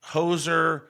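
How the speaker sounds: ordinary talk, nothing marked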